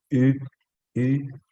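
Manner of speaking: "U" said close to an I sound; French U. Shown as a demonstration. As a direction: round the lips for "U" while saying an I. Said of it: "es más cercana a una I".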